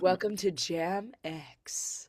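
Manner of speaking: slow, low late-night DJ purr; sultry radio voice. Pretend. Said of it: "that sultry radio voice now".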